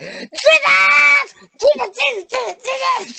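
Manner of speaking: high, loud, desperate wailing of Jesus; frantic crying out. Retold or imitated. Grandmother shrieking for Jesus to save her son, wailing. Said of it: "She just went berserk".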